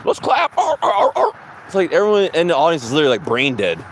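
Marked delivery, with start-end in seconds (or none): 0.1-0.5 high, far mock host voice cutting through a laugh; mock talk show host. Mocking a daytime TV host to her audience, high and silly.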